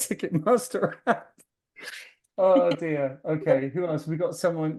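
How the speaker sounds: ordinary delivery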